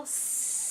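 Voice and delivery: long unvoiced snake hiss; steady breathy hiss like a snake. Shown as a demonstration. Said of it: "we're gonna make a S sound".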